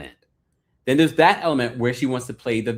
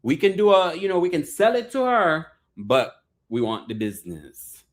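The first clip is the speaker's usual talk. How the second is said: loud, higher mock Caribbean accent; granddaddy's put-on accent; 6 semitones higher, 4 dB louder than usual. Host as granddaddy haggling over the business, mock Caribbean accent. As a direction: play the grandfather with an imitated island accent. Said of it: "Then Granddaddy's like".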